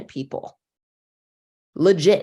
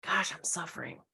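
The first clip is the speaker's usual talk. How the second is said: much softer, tender, hands on cheeks; tender self-soothing; 11 dB quieter than usual. Shown as a demonstration. Coach shows the listener the self-compassion phrase, softly tender. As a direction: say it gently to yourself like comfort. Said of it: "Do that to yourself".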